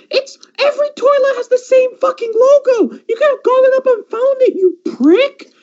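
high, whining rant; exasperated wail. Real, not acted. Venting to friend about useless cousin, high whining exasperation. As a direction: wail in mock despair. Ranting at cousin, cry heard.

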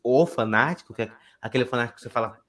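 normal talking voice

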